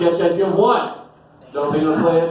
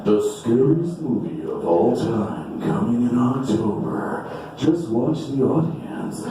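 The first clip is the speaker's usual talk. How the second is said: trailer voice; 6 semitones lower, 3 dB quieter, slower than usual. Retold or imitated. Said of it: "It's like".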